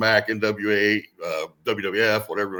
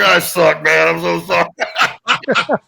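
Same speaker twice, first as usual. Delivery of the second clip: a loud, dragged-out, pleading apology; sorry confession; 5 semitones higher, 9 dB louder, much slower than usual. Retold or imitated. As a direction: moan it out, very slow. Confessing failure to his dad.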